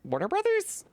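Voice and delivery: mock surprise.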